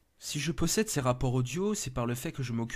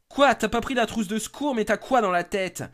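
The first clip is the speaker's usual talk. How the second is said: much higher, louder furious outburst; angry yell; 11 semitones higher, 10 dB louder than usual. Acted. Benjamin yells at Merlin for forgetting the first-aid kit, furious. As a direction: blow up at a careless partner.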